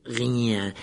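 drawn-out, distorted caretaker voice; mocking echo. A narrator's reading. Caretaker repeats Harry's answer, mockingly. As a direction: throw the word back with disbelief.

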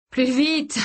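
high, urgent push to hurry; breathless, high-pitched command; urgent gasp. A narrator's reading. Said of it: "dit-il à Kirsty dans un souffle".

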